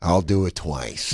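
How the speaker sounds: low, slow star impression; Jack Nicholson. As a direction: concede it slowly, down low. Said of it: "Jack Nicholson was like".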